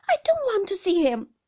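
put-on high, loud childish protest; upset child's outburst; refusing child. A narrator's reading. Child refuses to meet stepfather.

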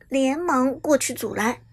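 plain reading voice

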